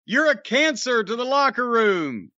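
lightly hammed-up accusation, pitched high; Jericho's scolding, mocked. Co-host mocking the wrestler's accusation to his rival, mock indignation. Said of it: "said Mister Pot to Mister Kettle".

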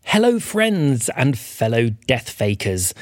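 usual speaking voice

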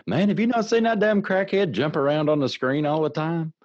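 high, loud mocking squeal. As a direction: squeal it out like a mock friend. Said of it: "they're like".

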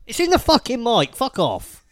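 loud, annoyed swearing; angry outburst. Real, not acted. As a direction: curse loudly at the mic. Annoyed at the mic.

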